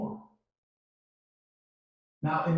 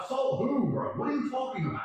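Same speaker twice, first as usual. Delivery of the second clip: incredulous; 10 semitones higher than usual. Retold or imitated. Incredulous reply to prosecutor.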